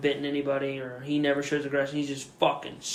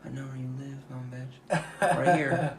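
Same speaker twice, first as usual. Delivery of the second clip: muttered threat; 6 dB quieter than usual. Retold or imitated. Retold muttered threat to the dog.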